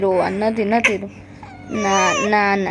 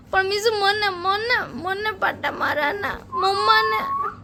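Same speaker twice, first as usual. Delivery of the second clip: mock whine; 8 semitones higher than usual. Mock crying complaint to baby.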